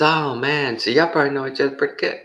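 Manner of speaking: very high, loud and fast; eager salesman. Retold or imitated. Salesman flattering the couple, very high, loud and fast. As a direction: gush it quickly. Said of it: "he says".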